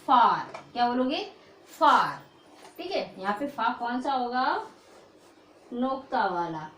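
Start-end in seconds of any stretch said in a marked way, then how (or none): 0.1-2.2 फार said louder with a long open aa; teacher models फार louder for the class to repeat; modelled word. Shown as a demonstration. (फार को ज़ोर से, लंबा आ खींचकर)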